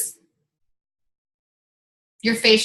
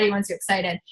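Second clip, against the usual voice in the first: ordinary talk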